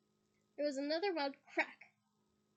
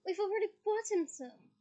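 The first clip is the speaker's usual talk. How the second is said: sour; 7 semitones higher, 5 dB louder than usual. Acted. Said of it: "said Fred sourly".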